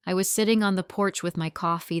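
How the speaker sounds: usual speaking voice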